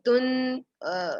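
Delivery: ordinary talk, nothing marked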